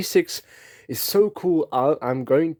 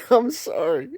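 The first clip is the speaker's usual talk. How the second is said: higher, giggled apology; laughing to tears; 9 semitones higher than usual. Real, not acted. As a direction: apologise as the giggles break back in. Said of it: "I'm smiling and I'm crying".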